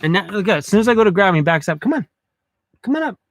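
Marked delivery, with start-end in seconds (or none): 1.8-3.2 higher, sing-song voice for a pet; coaxing the dog. Real, not acted. Calling the dog Cruz up onto the bed, higher. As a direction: coax a shy dog up to you.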